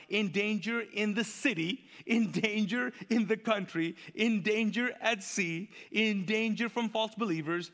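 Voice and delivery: high, repetitive, insistent list, slowing as it builds; Paul's rant. Acted. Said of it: "This is how you know he's ranting".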